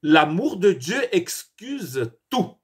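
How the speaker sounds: normal talking voice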